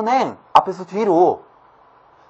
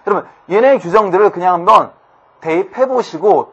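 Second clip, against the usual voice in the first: normal talking voice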